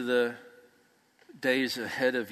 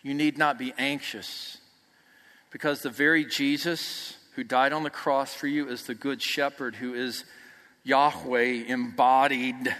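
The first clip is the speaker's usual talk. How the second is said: ordinary delivery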